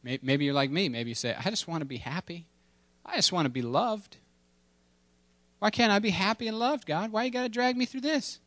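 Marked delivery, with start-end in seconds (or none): 1.4-8.4 rising higher, pleading whine to God; a hint of a sulking child; whiny complaint. Believer whining to God about hardship, rising whine.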